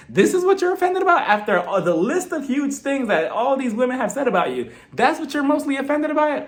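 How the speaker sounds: high-pitched, retold disbelief; incredulous. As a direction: act out being baffled at the complaint. Acted incredulous reaction to the complaint.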